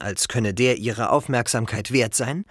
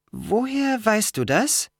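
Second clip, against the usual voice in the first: slow, high, wary question; cautious question; 10 semitones higher, slower than usual. A narrator's reading. Girl asks how he knows that, slow and cautious. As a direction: probe carefully, drawing it out high. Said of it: "fragte Annabeth vorsichtig".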